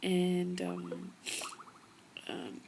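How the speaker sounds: usual speaking voice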